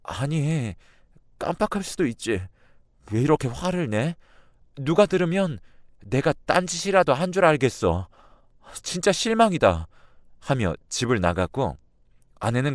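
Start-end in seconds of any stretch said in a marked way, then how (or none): 0.0-9.9 tearful. Retold or imitated. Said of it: "울먹이면서".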